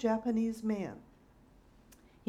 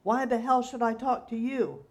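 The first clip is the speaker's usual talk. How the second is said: loud belligerent roar; 4 semitones higher, 6 dB louder than usual. A narrator's reading. Drunk laborer roaring at the old man, belligerent. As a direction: roar it back at him.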